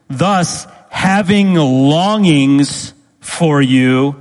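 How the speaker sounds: "Thus having longings for" drawn out slowly; slow literal reading. Shown as a demonstration. Preacher reads the literal Greek to his congregation, slow and weighty. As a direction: savour each word of the literal Greek. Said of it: "Literally in the Greek, it means".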